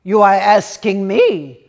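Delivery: loud indignant retort; loud affronted shout. Acted. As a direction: snap it back, loud. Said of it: "shouted Reb Leibish".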